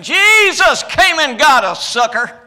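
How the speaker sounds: loud, high gloating shout at the lost; mocking taunt. Preacher imagines taunting sinners, loud mocking shout. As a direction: jeer it down from heaven's porch. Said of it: "That wasn't very nice".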